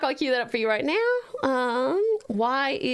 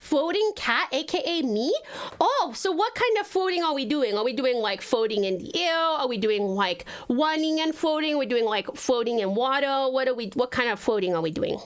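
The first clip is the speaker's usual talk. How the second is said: put-on baby talk. Streamer questions chat about floating animation, baby talk. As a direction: ask it all in baby talk. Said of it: "I will always talk like this now".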